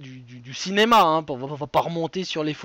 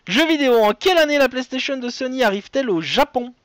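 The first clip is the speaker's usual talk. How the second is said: quiz host reading; 6 semitones higher, 4 dB louder, faster than usual. Real, not acted.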